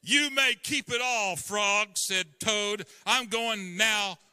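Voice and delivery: lively children's story read aloud, characters lightly voiced. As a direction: read it like a bedtime story to grown-ups. Reads Frog and Toad story.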